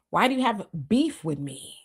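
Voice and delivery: normal talking voice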